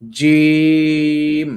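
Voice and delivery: heavy model জিম; thick pushed jim. Shown as a demonstration. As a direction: give জিম a hard inner push. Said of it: "ধাক্কা দিতে হবে ভিতর থেকে মোটা করে".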